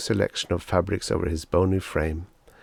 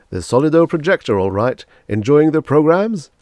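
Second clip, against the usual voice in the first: louder, beaming salesman flattery; gushing salesman; 8 semitones higher, 8 dB louder than usual. Acted. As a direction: lay the praise on thick. Salesman Pathis asks.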